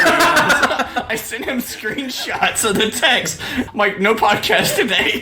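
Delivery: high-pitched, helpless laughter while talking; cracking up mid-story; laughing through words. Real, not acted. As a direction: tell it through giggles, voice climbing. Laughing while telling his prank.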